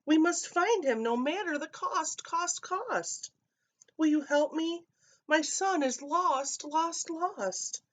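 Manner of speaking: high, wailing mother's plea; a mother crying out for her lost son; distraught mother. A narrator's reading. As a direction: plead like a frantic mother. Mother begging neighbors for help.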